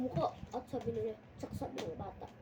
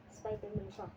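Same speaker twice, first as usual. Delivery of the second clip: ordinary talk, nothing marked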